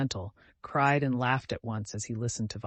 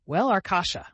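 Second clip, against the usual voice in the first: raised, emphatic dignified opening; mustering dignity; 7 semitones higher, 6 dB louder than usual. A narrator's reading. Vasya lying across the bed addressing his friend, mustering dignity. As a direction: begin with all the dignity you can. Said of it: "put all the dignity possible into his words".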